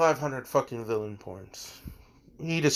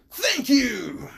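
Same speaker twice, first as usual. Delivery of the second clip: high, bright announcer-style thank you, much higher; hammed-up cheerful host voice; President Mike's thanks; 11 semitones higher than usual. President Mike thanking the student for silencing Ida, high showman voice. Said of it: "President Mike's just like".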